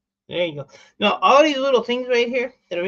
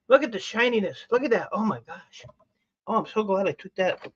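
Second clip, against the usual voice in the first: normal talking voice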